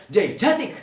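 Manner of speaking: "gigantic" with force on the middle syllable; stress on second syllable. Shown as a demonstration. Teacher shows how it is heard, stressing "gan" in "gigantic". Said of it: "So it can be heard as".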